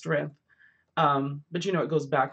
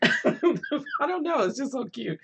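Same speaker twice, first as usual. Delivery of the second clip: laughing; 9 semitones higher, slower than usual. Real, not acted. Laughing at own greeting habit.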